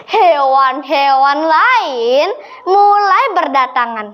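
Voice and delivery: plain reading voice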